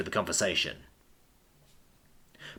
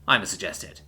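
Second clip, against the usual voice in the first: ordinary delivery, nothing marked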